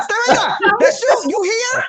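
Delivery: high-pitched, playful catching someone out; mock disbelief. Jesus finding her in the wrong places, mock disbelief. Acts out being caught by Jesus.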